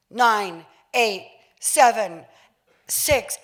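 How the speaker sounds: mock countdown. Film countdown acted out.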